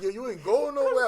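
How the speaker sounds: high, loud retold command; imitated aggressive order. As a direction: mimic her forceful demand, loud and high. Retelling her grabbing him against wall.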